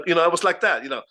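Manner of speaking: ordinary delivery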